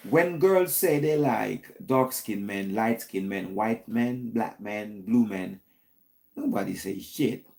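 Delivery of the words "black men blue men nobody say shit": ordinary talk, nothing marked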